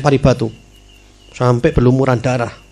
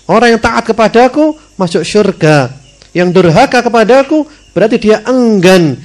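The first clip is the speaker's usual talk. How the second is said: 1 raised declaring voice; 10 semitones higher, 4 dB louder than usual. Acted. Explaining the Prophet's answer.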